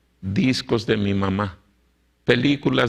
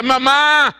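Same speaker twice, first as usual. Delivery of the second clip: put-on pleading boy; 13 semitones higher than usual. Marcos begging his mother to give up the records, high pleading voice. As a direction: beg your mother like a young convert. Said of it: "diciéndole a su mamá".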